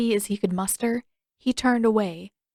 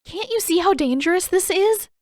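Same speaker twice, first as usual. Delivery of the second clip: high, loud and insistent; demanding; 10 semitones higher, 6 dB louder than usual. Acted. Sister scolding careless boy, demanding. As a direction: press him hard on the danger. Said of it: "she demanded".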